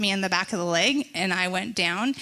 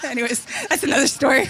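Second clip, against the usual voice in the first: quick, louder, through laughter; real giggly dismissal; laughing aside; 9 semitones higher, 5 dB louder, faster than usual. Real, not acted. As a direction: brush it off while cracking up. Laughing at her own admission.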